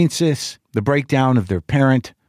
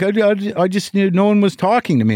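higher, halting, defensive father; whiny father; 7 semitones higher than usual. Retold or imitated. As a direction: stammer like a defensive old man.

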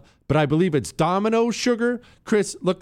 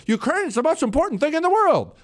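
higher, fast, lightly hammed-up politician pronouncement; mock politician; 11 semitones higher, faster than usual. Host mocking politicians who still put Ukraine first, higher and fast. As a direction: parody a politician grandly declaring it, quick and high. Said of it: "our politicians will still get up and say".

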